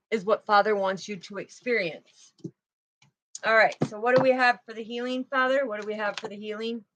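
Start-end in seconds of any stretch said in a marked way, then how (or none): 5.7-6.8 quiet, fast repeat of the question under her breath; murmured to herself. Real, not acted. Tarot reader asking Father about the healing, quiet murmur. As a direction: ask it again softly, almost to yourself.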